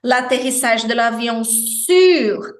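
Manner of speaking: slow "sur" with lips rounded for U, tongue at I, R sounded; slow French demo. Shown as a demonstration. Teacher isolates the sound, slow French demo. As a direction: stretch "sur" slowly to show the French U. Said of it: "aqui sim a gente tem o som do francês".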